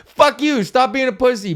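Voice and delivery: mock scolding yell. Mock-scolding the hesitant buyer.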